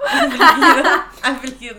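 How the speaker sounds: real laughter, starting loud and high; cracking up at the word afligido; laughing. Real, not acted. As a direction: giggle the word out.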